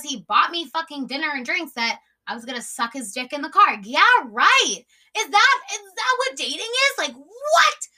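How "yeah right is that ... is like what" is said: loud, high-pitched incredulous outburst; scornful disbelief. Real, not acted. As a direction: scoff loudly, voice shooting up. Scoffing at her date's expectation.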